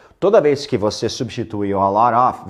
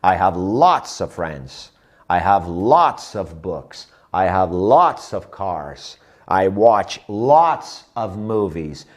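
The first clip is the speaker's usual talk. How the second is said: "lots" stressed in each slow sentence; 3 semitones lower, slower than usual. Shown as a demonstration. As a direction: lean on "lots", slow and clear. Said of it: "dá ênfase na palavra lots". (ênfase em "lots", frases lentas)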